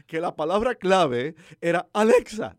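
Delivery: usual speaking voice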